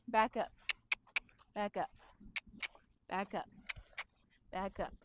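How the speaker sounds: calm cue fading to a murmur; steady cue that dies away softly. Shown as a demonstration. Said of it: "I'm just going".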